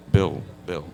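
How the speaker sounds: a hint of a low voice; lightly voiced deep actor's voice; imitating J B Blanc. Guest recalls a colleague on a podcast, lightly imitated deep voice. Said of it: "this is exactly how he sounds".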